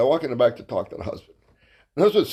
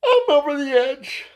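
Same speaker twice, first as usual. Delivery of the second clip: mock high-pitched reply; 12 semitones higher than usual. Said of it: "He said".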